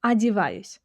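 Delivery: одеваюсь starting with an a sound; одеваюсь, first o reduced to a; unstressed o as a. Shown as a demonstration. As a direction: say одеваюсь as a-di-VA-yus. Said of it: "which is pronounced as a".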